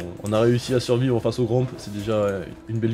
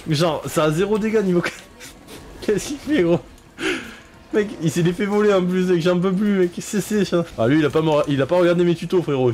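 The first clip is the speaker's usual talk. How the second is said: incredulous; 7 semitones higher than usual. Real, not acted. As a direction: laugh in disbelief at teammate. Incredulous at teammate's slowness. (incrédulité exaspérée)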